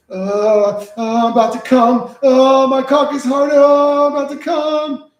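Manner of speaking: high, loud, hammed-up sing-song moans; mock moaning. Streamer trolls scam caller with loud hammed-up moaning.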